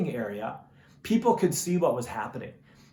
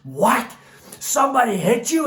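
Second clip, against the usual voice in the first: sudden high, loud outraged demand; a father shocked that someone hit his son; dad erupting in disbelief, much higher and louder; fired-up dad; 6 semitones higher, 6 dB louder than usual. Retold or imitated. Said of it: "my dad fires up in a way like I have never seen before".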